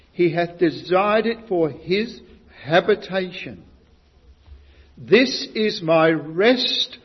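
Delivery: slow, weighty reading of the psalm; emphatic scripture reading. Acted. Preacher to congregation, slow emphatic psalm reading. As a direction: lean on each word, slow and solemn.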